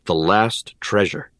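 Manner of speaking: usual speaking voice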